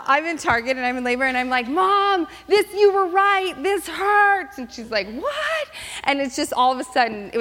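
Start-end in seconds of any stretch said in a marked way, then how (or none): 1.7-4.6 high, loud wail to mom; hammed-up pained wail, stretched out; pained cry. Retold labor cry to mom. 5.1-5.7 very high squeak of surprise; mock-shocked high "what"; shocked mom. Mom's shocked reply.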